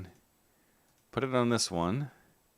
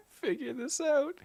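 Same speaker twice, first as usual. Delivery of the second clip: much higher, laughing through the words; 5 dB quieter than usual. Real, not acted. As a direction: giggle it out, half desperate. Laughing at broken tech.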